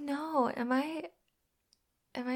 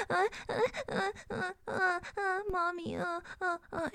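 high-pitched mock whimpering; 8 semitones higher than usual. Angry roommate mimicking what came through the wall, mocking whimper. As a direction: imitate someone's whimpers to shame them. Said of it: "like, whimpering and moaning all fucking night long".

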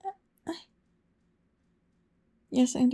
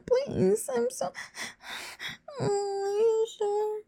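tearful high pleading; 7 semitones higher than usual. Acted. Pleading after refusal.

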